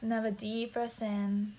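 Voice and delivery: slow, whispered meditation voice; guided meditation. Acted. Leads grounding meditation.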